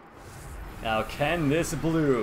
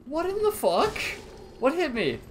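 high, loud startled cry; shocked disbelief; 10 semitones higher, 5 dB louder, slower than usual. Real, not acted. Player shocked at being suddenly hit. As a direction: yelp in sudden confusion.

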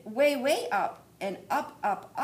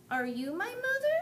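high, small questioning bird voice; a tiny chick asking hopefully; baby bird voice; 13 semitones higher, 4 dB louder than usual. Acted. Baby bird asks the hen, high and small. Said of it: "he said to the hen".

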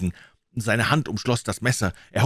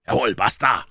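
sharp hissed retort, louder; hissing; 3 semitones higher, 5 dB louder than usual. A narrator's reading. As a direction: spit it out with menace. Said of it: "zischte der Indianer Joe".

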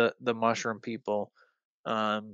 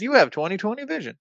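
doctor's verdict; 10 semitones higher, 4 dB louder, faster than usual. Retold or imitated. Doctor announces result.

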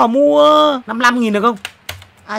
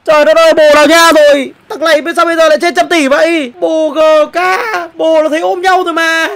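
loud and higher, disbelieving; shocked outburst; 7 semitones higher, 8 dB louder than usual. Real, not acted. Streamer stunned by a card price, loud. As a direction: cry out at the price.

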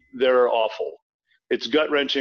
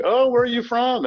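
put-on Southern accent; 13 semitones higher, 3 dB louder than usual. Asking a stranger about home, imitated Southern accent. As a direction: imitate a curious Southerner, pitched way up. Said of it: "I could feel it coming back".

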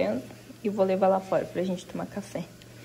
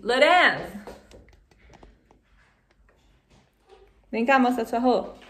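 calling out; 3 semitones higher, 10 dB louder than usual. Real, not acted. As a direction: call him to come over. Calling son from afar.

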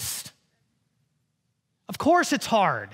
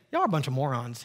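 low, softer mock insult; mock scornful insult; 9 semitones lower, 4 dB quieter, faster than usual. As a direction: sneer it, clearly not meant. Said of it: "He doesn't say".

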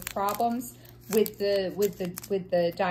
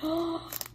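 little gasp; 6 semitones higher, 5 dB quieter than usual. Real, not acted. Finds special drill spot.

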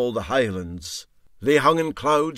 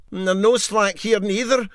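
higher and a bit louder, a complaining wail; 6 semitones higher, 4 dB louder than usual. A narrator's reading. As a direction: moan it out, plagued by midges. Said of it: "wailed Sonny Jim".

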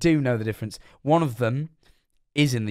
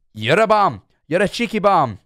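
louder, higher mock Scottish insult; Scottish accent; 6 semitones higher, 5 dB louder than usual. Streamer mocking a heckler in a Scottish accent, louder. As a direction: throw the insult in a hammed-up Scottish voice. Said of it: "and be like".

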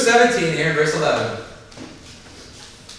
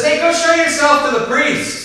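casual Jesus; 10 semitones higher, 8 dB louder than usual. Retold or imitated. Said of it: "And Jesus just says".

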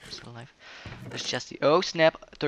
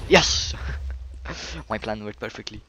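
triumphant shout; 6 dB louder than usual. Real, not acted. Plan worked, shouts in triumph.

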